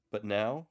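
"But now?" with a rising questioning tune. Shown as a demonstration. Lector posing the question to one listener, rising. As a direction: ask "But now?" and leave it hanging. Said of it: "as a question".

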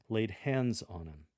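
"laid hands on 'em" slowly, 'em without th; drawn-out relaxed "'em"; casual 'em. Shown as a demonstration. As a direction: informal conversational lector read. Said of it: "drop the T H from your pronunciation".

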